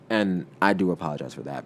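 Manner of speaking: usual speaking voice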